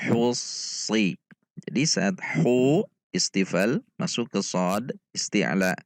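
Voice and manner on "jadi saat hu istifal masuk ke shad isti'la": ordinary delivery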